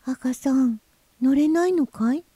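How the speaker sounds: high, anxious little fish; worried storybook question in a high voice. Acted. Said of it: "白は心配そうに言いました".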